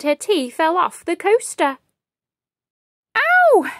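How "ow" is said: sharp high loud yelp of pain. Acted. Tripping and spilling tea, she yelps high and loud. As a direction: yelp as the hot liquid hits. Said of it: "she yelped".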